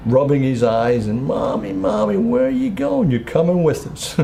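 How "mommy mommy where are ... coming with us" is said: put-on piping child's voice leaping high; five-year-old boy. Small son to his mother as she leaves, imitated high child voice. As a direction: imitate a little son asking Mommy. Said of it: "Little John comes through, five years old".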